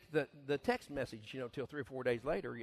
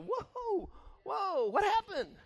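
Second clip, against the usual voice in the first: very high, slow whoa; surprised reaction to someone turning bitter; mock surprise; 3 dB louder, much slower than usual. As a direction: react as if startled.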